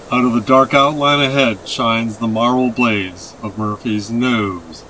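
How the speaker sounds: ordinary delivery, nothing marked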